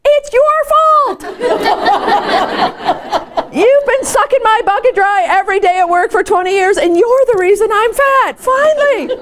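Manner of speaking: high, laughing mock accusation. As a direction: point the finger, laughing hard and high. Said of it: "she's like".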